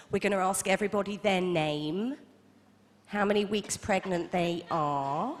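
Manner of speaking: slow, drawn-out sing-song condescension; syrupy instructor voice, very slow; patronising yoga teacher. Retold or imitated. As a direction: talk down to them like toddlers. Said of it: "extraordinarily patronising".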